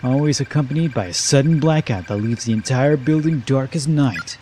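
low, hushed storyteller voice, drawn out; spooky story narration. A narrator's reading. Reading a ghost story to Mochida, low and hushed. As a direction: tell the ghost tale low and slow to draw them in.

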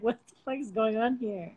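ordinary talk

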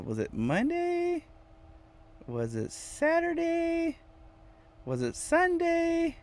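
very high, soft and slow, wondering aloud; silly high voice. Pretend. Musing to viewers about which day, in a silly high voice. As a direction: float the questions up in a tiny high voice. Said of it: "I don't know why I'm talking like this".

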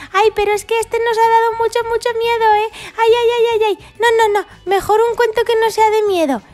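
very high, fast mock fright; high frantic dinosaur fear; mock panic. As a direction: squeal in hammed-up terror. Dinosaurs still scared.

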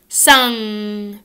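"sung," with a long back nasal NG. Shown as a demonstration. Teacher drilling sung against sun, nasal NG. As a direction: hum "sung," through the nose. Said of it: "Now say s".

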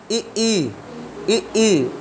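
ई, ई said with the tongue front pressed back; vowel demo. Shown as a demonstration. Teacher showing students how to say ई. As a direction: hold the tongue and sound ई, ई clearly. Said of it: "अगला वाला भाग कार्य कर रहा होता है".

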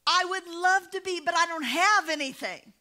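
high sheepish reply; a touch of mock panic; lightly voiced caught-out preacher. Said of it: "And he said".

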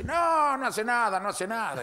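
high opening, easing down; breezy dismissal. Acted. Old man vouching for his loose dog, dismissive.

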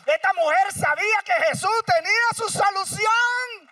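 crece hasta gritar, más alto y agudo; predicación encendida. Acted. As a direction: sube la voz hasta el clamor. Preacher builds to shout. (predicador gritando con fervor)

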